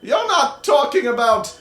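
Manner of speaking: put-on high old wizard crying out at the lady; excited old wizard. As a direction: exclaim like an agitated old wizard. GM voicing Delden.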